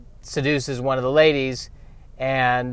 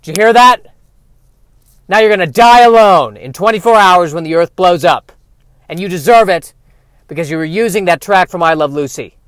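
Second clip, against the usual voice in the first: much louder and higher, yelling the verdict; furious alien rant; 5 semitones higher, 11 dB louder than usual. Pretend. Alien shouting at the rejected listener, loud and furious. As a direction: blast him with scorn, full shout.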